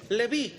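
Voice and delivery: Leví with the stress on the final í. Shown as a demonstration. Pastor correcting the congregation's pronunciation, stress on Leví's final i. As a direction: say Leví, leaning on the last syllable. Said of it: "Tiene acento en la I".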